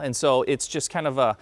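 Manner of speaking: ordinary talk, nothing marked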